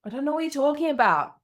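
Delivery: put-on high, quick child denial; six-year-old daughter. Daughter next day asked about the boat, high quick child voice. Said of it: "She's like".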